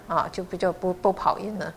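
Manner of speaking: ordinary delivery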